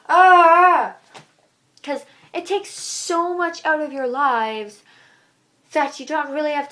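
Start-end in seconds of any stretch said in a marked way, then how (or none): 0.1-1.0 loud, higher cry of exasperation; frustrated scream. Real, not acted. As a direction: let the anger out in one yell. Rant about hating homework.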